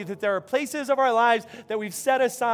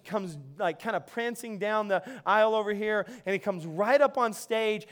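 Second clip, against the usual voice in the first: usual speaking voice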